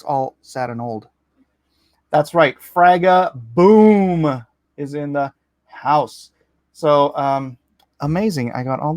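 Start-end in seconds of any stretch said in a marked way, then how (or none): 2.6-6.3 hype-man intro. Acted.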